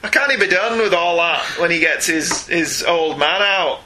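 slow, hammed-up Scottish brogue; funny Scots accent. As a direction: stretch the words in a comic Scots lilt. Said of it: "in a funny Scots accent".

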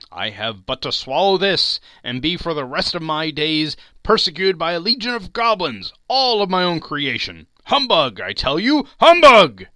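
put-on loud, high old miser, building to a shouted humbug; Scrooge, scoffing.

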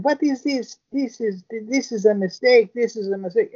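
mock frightened woman, high-pitched; high mock panicky woman. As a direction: play her flustered and high. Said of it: "she's going".

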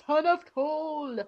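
grand, slow proclamation through giggles; Thor impression. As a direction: stretch it out like a hammy god. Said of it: "that's my Thor voice".

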